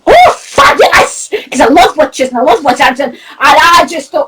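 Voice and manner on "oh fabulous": high, drawn-out gush; high-pitched gleeful exclamation; retold delight. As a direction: re-enact being thrilled, voice leaping up high. Retells her delight at the wizard.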